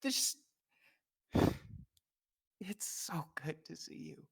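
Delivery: choked, whispered through tears; tearful whisper. Acted. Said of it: "tears are streaming down his face".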